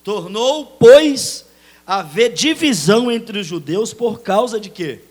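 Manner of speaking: loud, high reading of the verse; proclaimed scripture. Real, not acted. Pastor reading the verse aloud, loud and high.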